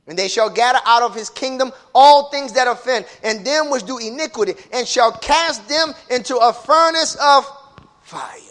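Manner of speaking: fiery preacher, high and loud; preaching at full force. Acted. Preacher warning the church about hell, fiery and loud. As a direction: thunder the sermon at the congregation.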